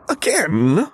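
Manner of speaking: high, startled squeak. A narrator's reading. Stunned young officer squeaking at news of Luke's prosthesis, high squeak. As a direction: squeak it out in disbelief. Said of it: "he squeaked out".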